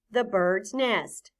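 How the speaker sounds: "The bird's nest." said slowly and clearly; careful slow demonstration; slow model phrase. Shown as a demonstration. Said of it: "Say that with me".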